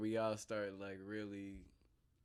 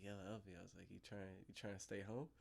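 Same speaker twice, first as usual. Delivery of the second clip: conspiratorial aside; 2 semitones lower, 11 dB quieter than usual. Retold or imitated. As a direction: quietly put the idea to him, softly. Secretly coaching brother to skip school.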